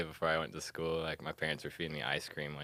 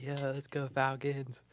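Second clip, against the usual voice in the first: mock cheer; 9 semitones higher, slower than usual.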